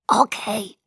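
weak chain-smoker's rasp; hoarse. A narrator's reading. Bella giving in to Jacob, hoarse weak voice. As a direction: answer through a raw throat. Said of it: "Stimme wie ein Kettenraucher".

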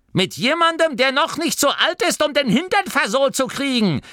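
put-on sharp old grandmother rebuke; scolding old woman. A narrator's reading. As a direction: tell the cheeky boy off. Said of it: "erwiderte Oma Morky".

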